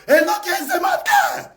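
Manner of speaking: high, slow, shouted taunt; mocking shout. Real, not acted. Taunting a rival on her live video, high slow shout.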